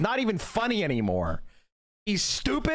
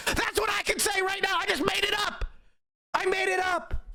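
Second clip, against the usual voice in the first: shouting; 12 semitones higher than usual. Real, not acted. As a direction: yell it in disbelief at yourself. Exasperated rant about the pope.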